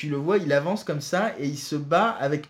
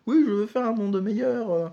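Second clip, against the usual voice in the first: mock noble speech; 7 semitones higher than usual. Mocking the villain's speech.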